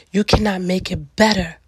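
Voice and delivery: normal talking voice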